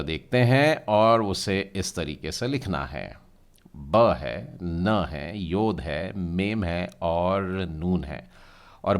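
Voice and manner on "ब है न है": normal talking voice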